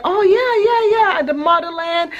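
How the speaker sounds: gushing about the motherland; excited guy. Retold or imitated. The guy hearing she is from Nigeria, high and excited.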